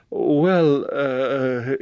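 high, drawn hesitant stammer. A narrator's reading. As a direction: stall nervously on a filler. James hesitates under the stare.

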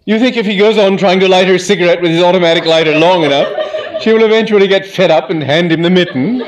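aunt with a carrying voice, demanding; Aunt Dahlia, carrying voice. A narrator's reading. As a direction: boom it across the room like calling cattle. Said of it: "has what you might call a carrying voice".

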